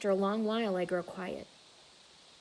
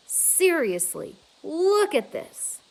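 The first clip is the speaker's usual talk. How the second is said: urgent insistence; 7 semitones higher, 6 dB louder, slower than usual. Acted. Julia insists.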